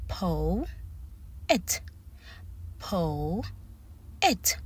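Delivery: "Po-et" split in two, long drawn-out O; emphasis on O. Shown as a demonstration. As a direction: stretch the O in "Po-et", then a separate "et". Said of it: "I am putting emphasis".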